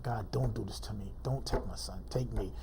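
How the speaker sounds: low, soft bargaining with God; retold plea. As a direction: plead quietly, as if in private prayer.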